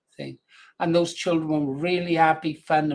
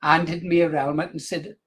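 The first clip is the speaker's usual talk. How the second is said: ordinary talk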